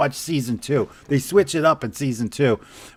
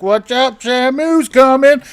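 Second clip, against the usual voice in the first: slow, higher teasing call; sing-song taunt at the wife; mock taunt; 10 semitones higher, 5 dB louder, slower than usual. Husband teases his wife in the hot tub, slow high taunt.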